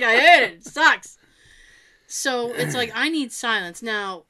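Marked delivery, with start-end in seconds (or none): 0.0-1.2 mock complaint. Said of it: "He's like".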